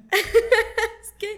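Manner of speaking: breaking into high laughter while talking; words caught up in a real laugh. Real, not acted. Laughing with the listener over finding the house, laughing.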